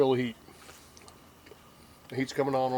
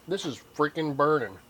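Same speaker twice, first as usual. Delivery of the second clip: ordinary talk, nothing marked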